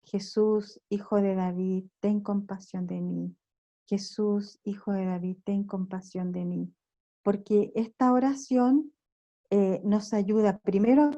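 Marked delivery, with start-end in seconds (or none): 0.0-3.3 soft, level-pitched recited invocation; repeated prayer. Shown as a demonstration. Preacher showing how the Orthodox repeat the prayer, soft recitation. 3.9-6.7 soft, level-pitched recited invocation; repeated prayer. Shown as a demonstration. Preacher showing how the Orthodox repeat the prayer, soft recitation.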